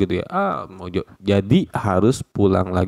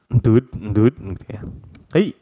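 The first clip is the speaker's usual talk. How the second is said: ordinary talk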